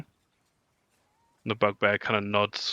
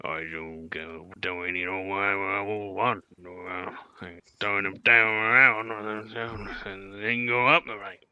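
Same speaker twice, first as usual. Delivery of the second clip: Barry Work voice; 4 semitones lower than usual. Acted. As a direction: voice the henchman in a low mumble. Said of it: "Barry Work pipes up and says".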